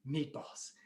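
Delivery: soft whisper to himself; quiet, whispered character aside; hushed musing. Acted. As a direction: whisper it as if planning a wish. Neighbour mulls his wish alone.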